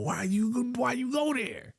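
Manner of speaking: pleading. Real, not acted. Pleading at the character.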